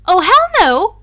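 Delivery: snatch it back with a shout; loud exclaim. A narrator's reading. Luna stops Harry crowning himself, with a loud shout. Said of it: "Luna exclaimed".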